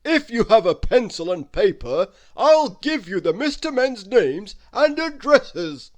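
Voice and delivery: beam with pleasure down the line; rumbling Father Christmas. Acted.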